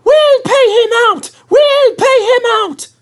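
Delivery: high squeaky plotting chant; scheming coaches. A narrator's reading. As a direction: plot revenge like naughty coaches.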